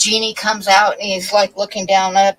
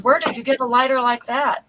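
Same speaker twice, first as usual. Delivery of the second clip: higher, incredulous asking; amazed question; 6 semitones higher than usual. Acted. Fisherman asks about his friend's giant lighter, amazed question. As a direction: ask in mock amazement.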